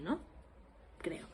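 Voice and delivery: ordinary talk, nothing marked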